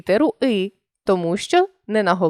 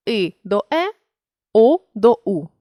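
normal talking voice

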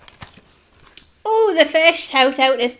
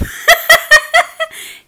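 high burst of laughter; 8 dB louder than usual. Real, not acted. Laughing at own accent.

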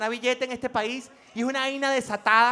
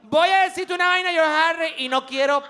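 ordinary delivery

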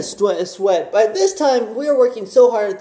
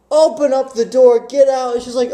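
usual speaking voice

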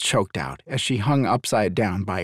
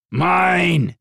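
higher, louder stubborn claim; a beast staking its claim; stubborn dragon claiming its prey; insistent dragon; 7 semitones higher, 4 dB louder than usual. A narrator's reading. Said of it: "his dragon insisted".

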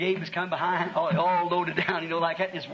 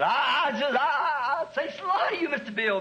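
put-on higher, stammering old man's voice, near tears; old Gabe stammering; 8 semitones higher than usual. Gabe refusing church to the pastor, higher stammering voice. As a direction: stumble over the I's like a flustered old man. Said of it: "he said".

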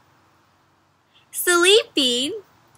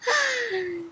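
high, soft pretend sigh; delighted sigh; 9 semitones higher, 9 dB quieter than usual. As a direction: let out a long happy sigh. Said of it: "he sighs with delight".

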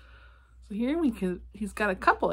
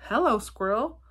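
louder storybook greeting, lightly voiced; calling out; 3 semitones higher, 6 dB louder than usual. A narrator's reading. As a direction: call out a friendly hello as Badger, mildly. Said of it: "Badger called out".

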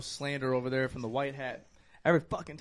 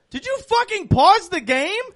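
mock angry gun; 14 dB louder than usual. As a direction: yell at her for pausing. Talking gun yells at streamer.

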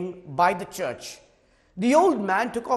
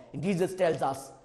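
ordinary talk, nothing marked

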